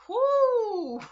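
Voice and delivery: high, loud whoop breaking into a laugh. Real, not acted. To her viewers, overcome by the lyric, a laughing whoop. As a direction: let out a joyful whoop.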